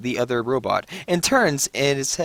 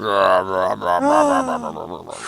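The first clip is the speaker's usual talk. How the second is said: crowd murmur; 5 semitones lower than usual. Pretend. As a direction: rumble like a doubtful crowd. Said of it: "Where's my murmur of disbelief?".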